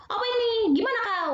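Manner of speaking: imitating a blunt Batak speaker; Batak accent. Lecturer imitating Batak speech for students, blunt and high. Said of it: "orang Batak beda lagi ngomongnya".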